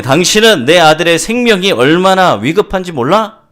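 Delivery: loud, high-pitched reproach; angry father yelling. Acted. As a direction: raise your voice at the doctor in anger. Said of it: "소리를 지는 거예요". (화난 아버지가 소리치며 따지듯)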